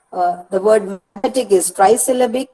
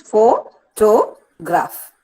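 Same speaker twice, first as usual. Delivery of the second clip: "Photograph" very slowly as pho, to, graph; syllable by syllable; much slower than usual. Shown as a demonstration. Teacher counting three units for students, syllable by syllable. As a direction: split "Photograph" into three parts with clear gaps. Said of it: "Three units".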